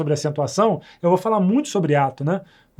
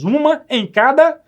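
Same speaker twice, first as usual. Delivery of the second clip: much higher and louder, slow; emphatic definition; 9 semitones higher, 7 dB louder, slower than usual. Shown as a demonstration. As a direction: stretch out uma em cada, high and loud. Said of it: "teacher emphasises definition".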